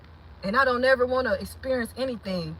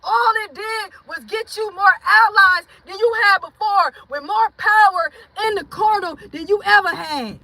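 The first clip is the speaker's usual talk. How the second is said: raised, high preaching cry; impassioned testifying; 7 semitones higher than usual. Real, not acted. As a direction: preach it straight at the doubters. Testifying to online haters.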